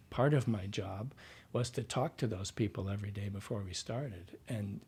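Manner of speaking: ordinary delivery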